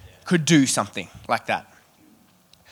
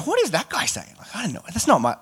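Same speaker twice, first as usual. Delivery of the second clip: lightly acted, high-pitched confused question; puzzled onlookers; 5 semitones higher than usual. Preacher playing baffled disciples at the table, lightly puzzled.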